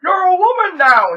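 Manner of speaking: loud high mock shout of the line; hammed-up yell of surprise from the film; retelling a film scene with a big shout. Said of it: "He's like".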